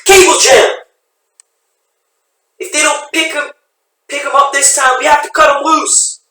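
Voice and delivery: alarmed yelling; shouting. Acted. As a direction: yell the problem urgently. Urgent rescue shouts.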